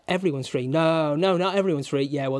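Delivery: usual speaking voice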